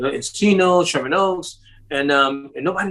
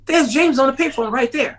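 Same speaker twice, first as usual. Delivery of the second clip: higher, urgent pointing out; surprised spotting; 10 semitones higher than usual. Retold or imitated. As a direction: point him out in sudden surprise. Friend spotting James, retold.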